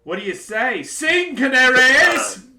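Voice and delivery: very high, loud, slow taunt; gleeful king. Acted. King mocking the party as canaries, loud and high. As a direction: crow it like a delighted tyrant.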